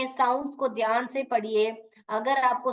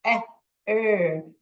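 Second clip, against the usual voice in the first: ordinary delivery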